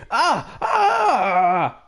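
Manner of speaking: short badly faked scream; hammed-up scream that falls flat; terrible scream. Acted fright in the haunted house, badly faked scream. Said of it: "that was a terrible scream".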